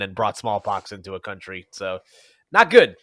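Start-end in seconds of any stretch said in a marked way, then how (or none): 2.5-3.0 NOT punched high and louder; emphatic not good. Real, not acted. To cohost, a loud mock-grave verdict. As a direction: land hard on not. Joking about colonial history.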